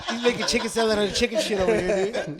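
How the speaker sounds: talking through laughter; laughing. Real, not acted. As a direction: say it cracking up. Laughing while joking.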